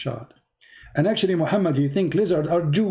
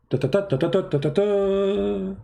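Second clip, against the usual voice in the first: mock counting; 6 semitones higher than usual. Imitates Muhammad counting lizard fingers.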